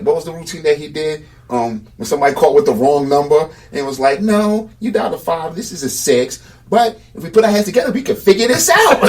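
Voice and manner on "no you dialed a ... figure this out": mock-earnest caller, building louder and higher; retold comedy bit. Retelling a wrong-number routine, playful comic imitation. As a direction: imitate a comic, pitch climbing high.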